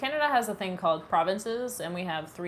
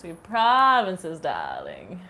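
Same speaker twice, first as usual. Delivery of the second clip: slow, hammed-up fancy society voice; super fancy; 9 dB louder, much slower than usual. Shown as a demonstration. Streamer correcting chat's idea of her, super-fancy voice. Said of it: "I meant super fancy".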